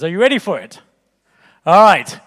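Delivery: usual speaking voice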